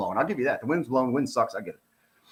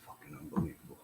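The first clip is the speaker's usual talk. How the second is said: breathy disgusted whisper, much softer; a muttered aside, barely voiced and much softer; whispered disbelief; 13 semitones lower, 19 dB quieter, faster than usual. Real, not acted. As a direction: hiss it under your breath in disbelief.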